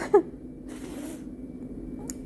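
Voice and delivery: high airy sob-laugh; real tears breaking through; crying laugh. Real, not acted.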